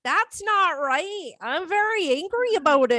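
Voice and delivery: sweet Minnesota accent trying to be angry; politely cross in a put-on Minnesota accent; mild Minnesota anger. Shown as a demonstration. Telling Jim off for a wrong name, sweet Minnesota accent. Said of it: "angry in a Minnesota accent".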